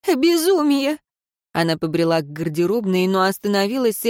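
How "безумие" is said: distraught cry. A narrator's reading. Repeated in rising distress.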